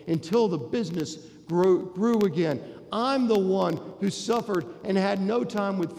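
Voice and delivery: a resentful son unloading years of grievance, rising in force; older brother's resentment. Acted. Older brother's building rant at father.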